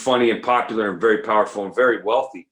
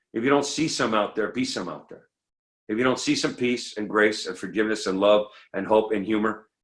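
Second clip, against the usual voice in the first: usual speaking voice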